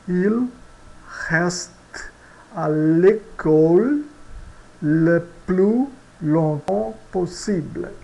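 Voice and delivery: Il reste à l'école read slowly and clearly; slow French example. Shown as a demonstration. Teacher reading the example sentence slowly to students. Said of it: "Vamos ver a nossa frase exemplo".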